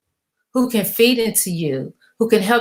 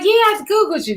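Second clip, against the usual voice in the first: high-pitched mimicking of callers; imitated caller; 11 semitones higher than usual. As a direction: put on a caller's voice, pitched right up. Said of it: "they be like".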